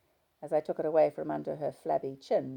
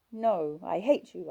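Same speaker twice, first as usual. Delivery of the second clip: angry; 4 semitones higher, 6 dB louder, slower than usual. A narrator's reading. Wife in tearful quarrel.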